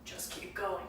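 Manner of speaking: put-on lightly gravelly old man's voice; soft gravelly ghost voice. Shown as a demonstration. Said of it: "I can probably do it right now".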